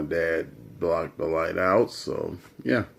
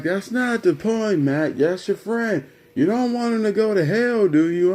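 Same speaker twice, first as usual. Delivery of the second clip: high-pitched mock pleading from Dad; exasperated dad; 11 semitones higher than usual. Dad pressing his son about his friend, exasperated. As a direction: push the point in a raised, higher voice.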